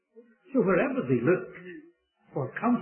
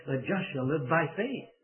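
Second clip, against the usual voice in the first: "faith" raised and falling at the end; stress on FAITH. Retold or imitated. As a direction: save the weight for the last word, "faith". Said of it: "the emphasis on the faith".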